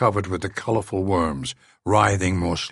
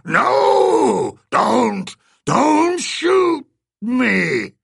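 very high, loud, drawn-out alien plea; panicked creature cry; 6 dB louder, much slower than usual. A narrator's reading. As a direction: shriek it out, begging for its life. Beast pleading not to be shot.

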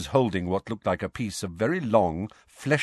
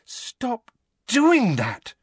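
high, drawn-out, shaken protest; weak protest; 4 dB louder, much slower than usual. Acted. Hermione scolds the twins after a fright, weakly. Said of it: "Hermione said weakly to the twins".

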